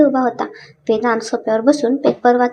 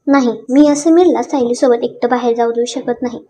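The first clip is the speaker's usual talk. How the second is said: plain reading voice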